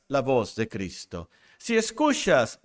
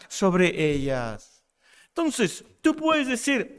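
raised, insistent preacher's voice; impassioned preaching; 6 semitones higher than usual. Real, not acted. Preacher tells the congregation who the true sheep are, impassioned preaching. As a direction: preach it in mounting, emphatic phrases.

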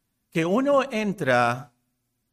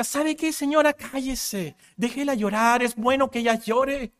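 wanted to yell; 7 semitones higher than usual. Retold or imitated. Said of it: "yo quería gritarle a ella".

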